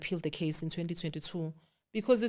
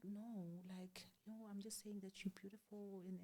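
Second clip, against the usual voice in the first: hushed whisper, much softer; 22 dB quieter than usual. Retold or imitated. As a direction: murmur it secretly, afraid of being overheard. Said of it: "Why are you whispering?".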